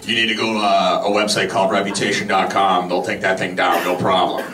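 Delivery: low voice imitating his friend Jim; Jim impression. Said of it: "It's my Jim impression".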